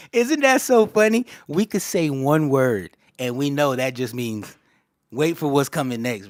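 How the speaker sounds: riding the laugh, loud and high; excited laughing talk. Real, not acted. To the chat, giddy and loud about bruh.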